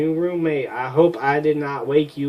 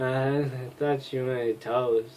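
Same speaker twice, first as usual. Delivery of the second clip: normal talking voice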